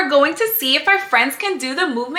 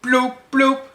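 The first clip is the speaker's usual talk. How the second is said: fish bloop sounds; 4 semitones higher than usual. Pretend. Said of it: "And she says bloop".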